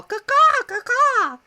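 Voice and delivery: high squawking caw-caw; crow caw impression. Shown as a demonstration. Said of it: "It's a good impression".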